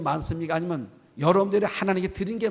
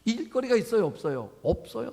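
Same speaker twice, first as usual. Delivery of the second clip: ordinary talk, nothing marked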